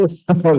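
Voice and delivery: short real laugh; light giggle; amused chuckle. Real, not acted. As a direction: laugh at your own joke. Laughing at own impression.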